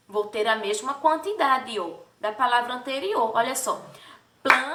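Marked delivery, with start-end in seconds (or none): 4.4-4.7 "planeta" said with a clap on each syllable; clapping syllables. Shown as a demonstration. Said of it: "bater palmas para cada sílaba".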